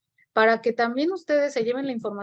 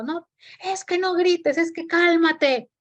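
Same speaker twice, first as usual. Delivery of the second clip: mock parent yell, higher, slowed; yelling at the child; 7 semitones higher, 4 dB louder, slower than usual. Parent yelling at the child to stop yelling, hammed-up shout. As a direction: shout calm down while shouting yourself. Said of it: "le digo al niño gritando".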